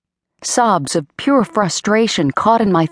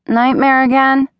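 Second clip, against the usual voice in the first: higher, louder drowsy roommate; sleepy voice; 8 semitones higher, 4 dB louder than usual. Acted. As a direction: ask it half asleep. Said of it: "came a sleepy voice".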